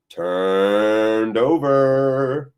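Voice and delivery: very slow, stretched "turned over"; elongated commentator's call; drawn-out call. Real, not acted. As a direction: hold each word long, sliding upward.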